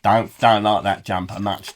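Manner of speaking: usual speaking voice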